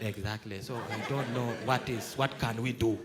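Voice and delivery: a hint of a baffled officer, low and soft; low, slow sheepish officer voice. Baffled officer to colleague, in a low mumbling voice. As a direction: mumble it like a lost policeman.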